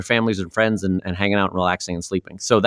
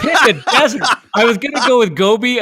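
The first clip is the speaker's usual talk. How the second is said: amused repeat of his own flub; laughing; 12 semitones higher, 4 dB louder than usual. Real, not acted. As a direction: repeat it higher and louder through laughter.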